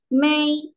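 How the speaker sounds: May held high and level; first tone. Shown as a demonstration. Tone mnemonic for students, first tone. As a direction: stretch May on a high, even pitch. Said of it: "nada satu".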